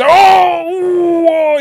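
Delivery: high hammed-up wobbly groans; mock dazed groan. Mocking a fighter rocked by a punch, hammed-up groans. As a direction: act out a fighter who just got hit. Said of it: "he's waffling a bit".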